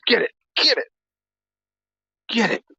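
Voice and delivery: high pitched play voice; squeaky dog voice. Real, not acted.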